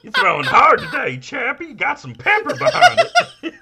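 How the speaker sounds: very high, loud, laughing; goofy high catcher cheer with giggles; John C. Reilly voice. Retold or imitated. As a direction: cheer the pitcher on in a squeaky voice. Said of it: "then I did the voice".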